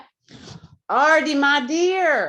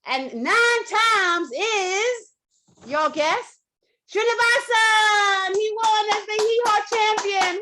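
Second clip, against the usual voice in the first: slow teasing reveal that climbs much higher; building excitement; 9 semitones higher than usual. Real, not acted. Host revealing the hee-haw champion, slow and rising. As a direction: draw out the suspense, then crown him.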